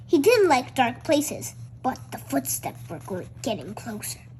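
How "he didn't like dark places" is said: ordinary delivery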